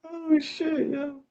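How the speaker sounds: laughing. Real, not acted. Laughing fit, high pitch.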